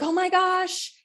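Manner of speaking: high, gushing oh my gosh; imitating her thrilled squeal; mock delighted gasp; excited. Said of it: "truly authentically excited".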